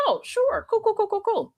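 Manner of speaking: very high-pitched acted agreeing; breezy agreement. Agreeing to sit with friend's relative, breezy and high.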